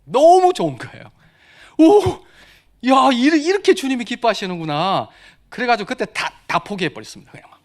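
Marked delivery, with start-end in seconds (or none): 1.8-5.1 higher, louder delighted cry; joyful exclamation. Retold or imitated. Believer feeling joy of obedience, exclaiming happily. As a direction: burst out in glad wonder.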